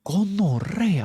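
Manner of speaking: high, drawn-out frightened cry; scared shriek. Retold or imitated. Boys yelping as the computer answers correctly, high and frightened. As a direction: shriek it like the program just spooked you. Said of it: "Y nosotros como".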